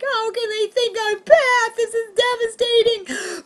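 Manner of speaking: very high, slow mock wail; convulsing in tears. Comic retelling her breakdown at home, mock sobbing. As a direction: wail it out, stretched and squeaky. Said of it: "I am literally convulsing in tears".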